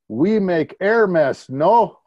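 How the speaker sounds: put-on high, slow, loud Saudi-accented English. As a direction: imitate the Saudi pilot's accent, drawn out and amused. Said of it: "In his Saudi accent".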